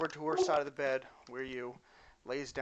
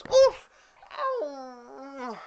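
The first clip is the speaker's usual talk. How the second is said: very loud high oof then small ow; comic yelp of pain; 11 semitones higher, 8 dB louder than usual. Acted. Clumsy girl poking her own eye, loud high yelp.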